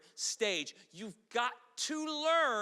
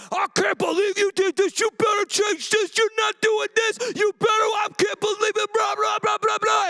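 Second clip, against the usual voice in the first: hammed-up spouse yelling, much higher and louder; mock yelling; 10 semitones higher, 11 dB louder than usual. Preacher imitating a spouse yelling in a fight, hammed-up shout. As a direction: yell it at your spouse in mock rage. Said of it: "And you be yelling".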